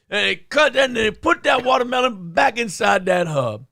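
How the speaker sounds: P D Green voice. Pretend. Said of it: "I'll do P D Green".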